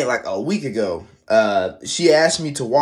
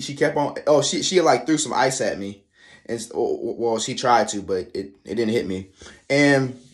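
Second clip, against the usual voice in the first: normal talking voice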